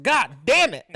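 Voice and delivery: loud, high jolt of alarm; acted startled cursing. Retelling the raccoon lunging at him, startled yelp. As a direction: curse as if something just lunged at you.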